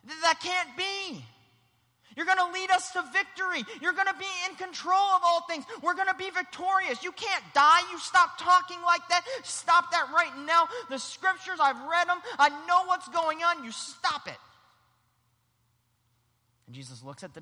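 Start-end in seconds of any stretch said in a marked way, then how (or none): 0.1-1.2 slow, high yell of protest; Peter yelling. Acted. Peter refusing what Jesus foretells, yelling. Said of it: "literally starts to just yell". 2.1-14.4 high, sustained shouting rebuke; Peter yelling. Acted. Peter rebuking Jesus, yelling. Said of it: "literally starts to just yell".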